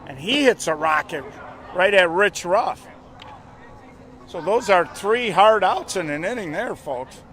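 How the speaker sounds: loud, high play-by-play call. Acted. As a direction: call it big over the crowd.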